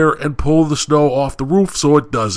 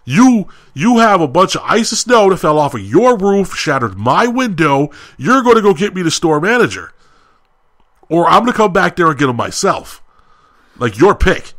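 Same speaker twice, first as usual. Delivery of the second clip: raised, heated demand at the counter; retold angry outburst; 6 semitones higher, 4 dB louder than usual. To the McDonald's assistant over the damaged car, angry raised voice. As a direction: cut him off and lay down an ultimatum, loud. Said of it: "I cut him off".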